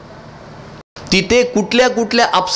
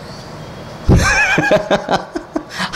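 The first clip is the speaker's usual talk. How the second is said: high-pitched laughing fit; mocking laugh. Real, not acted. Scoffing at the audience's hero Ravana, high mocking laugh.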